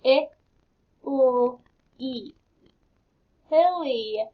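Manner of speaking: letter by letter, then belly blended; teacher sounding out letters. Shown as a demonstration. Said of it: "let's sound these words".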